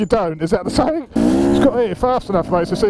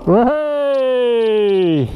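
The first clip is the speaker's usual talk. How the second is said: cheer; 6 semitones higher than usual. Real, not acted. Cheering a mate up the hill.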